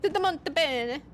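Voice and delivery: put-on high-pitched playful Italian accent. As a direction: call out for it in a hammed-up Italian accent. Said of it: "saying it in an Italian accent like that".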